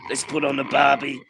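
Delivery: low mock Australian manager; deflated Aussie interviewee impression; Ange. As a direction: mimic a downcast coach, head down. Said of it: "This is the same voice and the head down".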